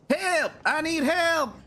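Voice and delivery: high-pitched acted plea for help; crying for help. Game character calling out for help, alarmed.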